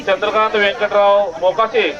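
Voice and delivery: ordinary talk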